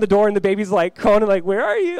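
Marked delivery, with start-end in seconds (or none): 1.4-2.0 a sing-song, much higher "where are you"; playful seeker. Retold or imitated. Baby chasing mom at hide and seek, playful. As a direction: call out like a toddler hunting for mom.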